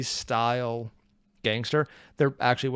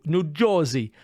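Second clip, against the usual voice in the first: attempted New Jersey accent; 2 semitones higher, slower than usual. Shown as a demonstration. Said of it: "after all the accents I can't do".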